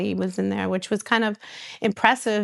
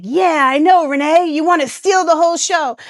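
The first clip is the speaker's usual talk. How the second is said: louder, higher, picking a fight; imitating Drita snapping at Renee; mock argument; 8 semitones higher, 7 dB louder than usual. Drita picks a fight with Renee in the meeting, mock angry.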